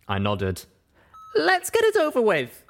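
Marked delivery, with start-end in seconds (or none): 1.3-2.6 high, loud, resigned; just get it done; high resigned young mare; Pip resigned. Acted.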